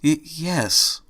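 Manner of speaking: barely manage the answer; nervous. A narrator's reading. Fluttershy confirms her identity to Angel, nervous stammer. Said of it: "increasingly rapid nervous breathing".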